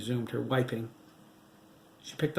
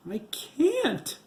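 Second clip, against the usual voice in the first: high, louder sobbing plea; 7 dB louder than usual. A narrator's reading. Widow sobbing to her ghost husband, much higher. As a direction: sob it out, voice breaking high. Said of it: "Nancy sobbed".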